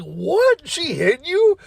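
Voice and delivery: high-pitched astonished question; retold shock. Coworker learning boss hit worker, imitated shock. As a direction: imitate disbelief with pitch leaping up.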